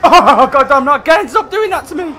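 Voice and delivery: loud, frantic cry; panicked yelling. Real, not acted. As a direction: shout in frustrated fear. Monster attacks again.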